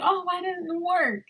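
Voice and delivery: lightly mocking a nosy stranger; nosy woman. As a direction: voice the curious woman asking too much. Woman asks nosy question.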